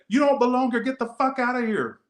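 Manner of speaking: high mocking yell of the strangers; mock yell from passing car. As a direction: imitate strangers yelling out the window. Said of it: "They said".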